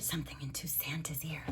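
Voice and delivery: unvoiced storybook whisper; hushed secret-telling. Shown as a demonstration. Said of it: "reads whispering line whispered".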